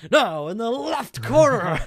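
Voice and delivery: high, slow, booming ring announcer call; UFC announcer. Retold or imitated. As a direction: draw it out like a fight night announcer. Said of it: "this U F C announcer".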